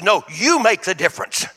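ordinary talk, nothing marked

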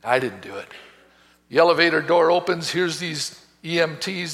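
usual speaking voice

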